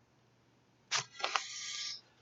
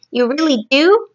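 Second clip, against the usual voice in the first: a touch higher, eager and hopeful; excited cry; 6 semitones higher than usual. Acted. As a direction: brighten up with delight. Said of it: "cried the B F G".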